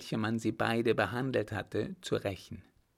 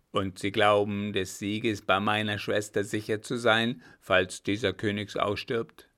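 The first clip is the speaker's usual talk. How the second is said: captain's voice, firmly louder; a louder grim captain demanding a straight answer; loud stern captain; 5 dB louder than usual. Acted. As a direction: press him hard, like a gruff officer. Captain grills the baron.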